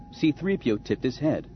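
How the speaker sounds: ordinary delivery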